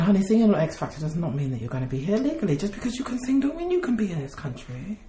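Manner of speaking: lightly voiced Caribbean-style accent; a hint of an island lilt, teasing; put-on accent. Telling a contestant she must leave, in a light Caribbean accent. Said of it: "What is this accent?".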